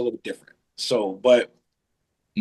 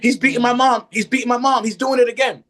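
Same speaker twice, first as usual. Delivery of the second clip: panicked pleading; 9 semitones higher than usual. Retold or imitated. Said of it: "I'm panting".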